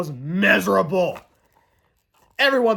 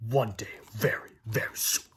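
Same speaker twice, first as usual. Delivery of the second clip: much lower, much softer mean principal; menacing vow; 8 semitones lower, 7 dB quieter than usual. Acted. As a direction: growl the threat low and quiet. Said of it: "Mister Krupp vowed".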